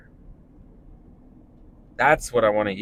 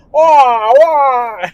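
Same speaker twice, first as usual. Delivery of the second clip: much higher, louder warbling attempt at a voice; failed Jimmy Stewart; 11 dB louder than usual. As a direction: flail at the old-movie drawl. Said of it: "I couldn't do a Jimmy Stewart impression".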